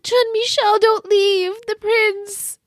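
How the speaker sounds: high whiny Blair. Retold or imitated. Blair begging Jean-Michel not to leave, high mock whine. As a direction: ham up a spoiled girl begging him to stay.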